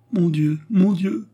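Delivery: high, slow, tearful exclamation; tearful murmur. A narrator's reading. Turquoise gives in to her lover, tearfully. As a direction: draw it out high, melting into tears. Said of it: "murmura-t-elle en fondant en larmes".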